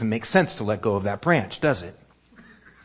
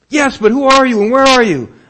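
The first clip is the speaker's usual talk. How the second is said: calling up, anxious; 13 semitones higher, 12 dB louder than usual. Acted. Jack calls up to the voice.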